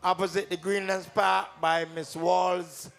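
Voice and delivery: ordinary talk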